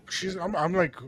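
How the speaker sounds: ordinary talk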